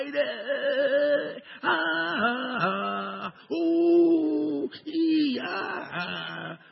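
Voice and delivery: high mock wails, eh ah ooh; imitated wailing. Preacher mimics African mourners wailing. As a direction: ham up the mourners' wailing cries. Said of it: "you'll be seeing people cry".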